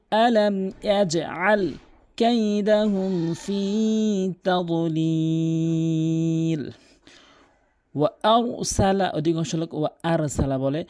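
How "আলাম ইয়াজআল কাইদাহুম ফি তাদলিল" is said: slow, drawn-out melodic chant; Quran recitation. Shown as a demonstration. Teacher reciting the verse for students, slow melodic chant. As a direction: let each syllable ring long.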